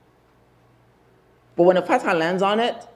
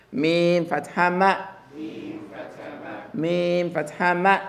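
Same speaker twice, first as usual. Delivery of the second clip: slow, drawn-out model recitation; teacher drilling slowly; slow spelling drill; 3 dB quieter, slower than usual. Shown as a demonstration. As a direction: spell it slowly for the class to repeat. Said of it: "gets to be light".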